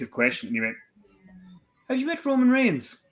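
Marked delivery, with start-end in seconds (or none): 1.9-3.0 eager fan. Retold or imitated. Fan asks about Roman Reigns.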